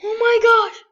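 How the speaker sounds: loud, high cry of disbelief; real high-pitched, overwhelmed exclamation; thrilled shock. Real, not acted. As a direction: cry out in amazed excitement. Reacting to the trade result.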